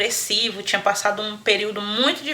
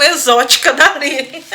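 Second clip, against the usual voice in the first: normal talking voice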